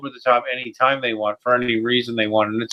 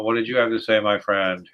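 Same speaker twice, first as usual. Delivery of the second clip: ordinary talk, nothing marked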